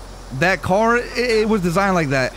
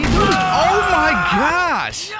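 stretched disbelief; 9 semitones higher, much slower than usual. Real, not acted. Reacting to shocking scene.

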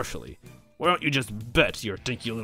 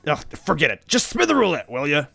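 fed-up, high and louder order; irritated raised-voice command; exasperated; 5 semitones higher, 5 dB louder than usual. A narrator's reading. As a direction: give up and snap at your servant. Bowser exasperated, orders the spin.

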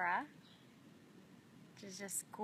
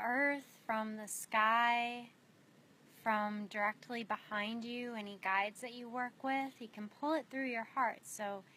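unhurried meditation guide with long pauses; spacious, measured guiding voice, letting each image settle; guided meditation. Real, not acted. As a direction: lead them gently inward, phrase by phrase.

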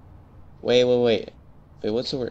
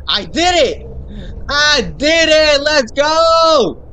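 high loud triumphant yell; victory shout; 10 semitones higher, 4 dB louder, much slower than usual. Real, not acted. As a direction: scream the win to the world. Celebrating finishing the game.

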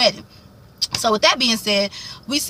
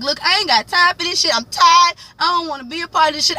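fed-up bride; 7 semitones higher than usual. Retold or imitated. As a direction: snap that she's tired and out. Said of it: "She like".

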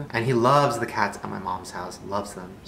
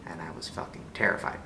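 whisper; 6 semitones lower, 10 dB quieter than usual. Real, not acted. Recalling the tense meeting.

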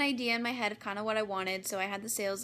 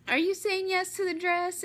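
sales lady; 9 semitones higher, 4 dB louder than usual. Retold or imitated. Voicing the sales lady's question.

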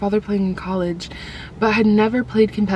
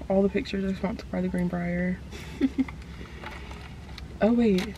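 ordinary talk

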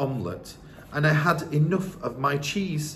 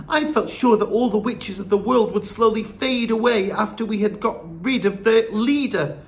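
put-on high, urgent boy voice; boy arguing in disappointment; frustrated young grandson; 6 semitones higher, 3 dB louder than usual. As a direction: protest hotly, pitched up.

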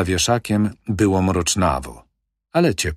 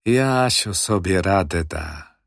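plain reading voice

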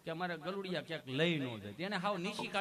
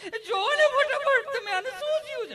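much higher, much louder hammed-up panic screams; panicked shrieking mother; 11 dB louder than usual. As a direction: shriek for help like the child is dying. Panicking mother demanding help. (ગભરાટમાં ખૂબ ઊંચી, મોટી રાડો)

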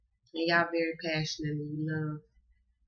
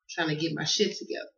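usual speaking voice

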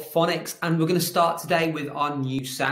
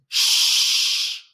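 strong, sustained shush; horse's shush; 5 dB louder than usual. Acted. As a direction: hush the noisy snake firmly. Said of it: "It's getting very angry".

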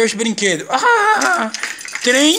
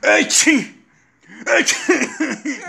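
high playful clown call; 6 semitones higher, much slower than usual. Acted. Clown dad making baby laugh.

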